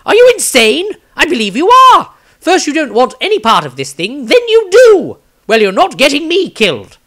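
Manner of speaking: a high-pitched panicky rant, outraged at his companion; squeaky outraged wizard voice; Maestro, indignant. Acted. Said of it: "Maestro commented".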